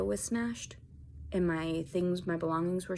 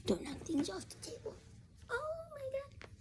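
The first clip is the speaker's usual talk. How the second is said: much higher, softer cooing pet voice; 13 semitones higher, 7 dB quieter than usual. Real, not acted. Talking to her kitten Rocket in high cooing baby talk.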